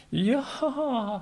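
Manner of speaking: "いやー" drawn out very slowly, high and soft; evasive hedge. Shown as a demonstration. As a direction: stall with a long, uneasy "いやー". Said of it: "って言うんじゃなくて". (言葉を濁す「いやー」)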